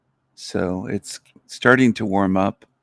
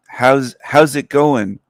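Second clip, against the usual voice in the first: slow, drawn-out How's it going, higher and louder; Canadian greeting; 4 semitones higher, 5 dB louder, slower than usual. Shown as a demonstration. Showing chat how Canadians greet, slow Canadian drawl. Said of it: "Canadians say it is".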